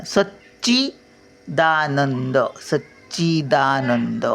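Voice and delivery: Sachchidanand slow with the doubled च pressed; weight on च. Shown as a demonstration. Grandmother showing children where to press, slowly. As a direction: lean on the च in Sachchidanand. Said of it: "मग च वर भार द्यायचा बघा".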